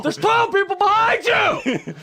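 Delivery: high, loud, slow shout; angry shout. Pretend. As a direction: bark it at the customer. Yelling angry staff.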